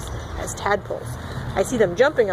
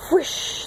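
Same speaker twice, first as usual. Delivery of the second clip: whispered whoosh; 5 semitones higher than usual. Pretend. Bubble sound effect.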